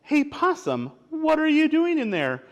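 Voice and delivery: high, lively storybook animal voice; high and inquisitive; curious animal character. Acted. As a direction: ask in a high-pitched, nosy critter voice. Voicing a story animal calling to possum.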